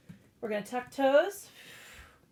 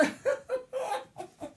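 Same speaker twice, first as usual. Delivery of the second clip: high, breathy burst of laughter. Real, not acted. Teacher cracking up at herself mid-class. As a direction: laugh it off after the rant. Laughing at her own rant.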